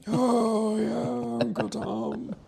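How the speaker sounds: put-on high, wheezy old man. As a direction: croak it like an ancient uncle. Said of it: "I did an old man voice".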